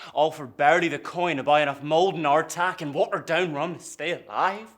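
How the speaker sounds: loud, high-pitched indignant complaint; bitter grievance. Acted. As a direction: pour out the grievance with rising force. Builds grievance about sailors' lives.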